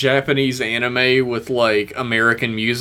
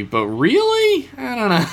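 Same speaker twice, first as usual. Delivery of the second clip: mock dramatic; 10 semitones higher than usual. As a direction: ham up the uncertainty.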